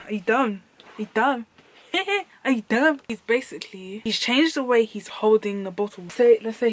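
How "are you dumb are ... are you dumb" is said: laughing mockery. Mocking, laughing.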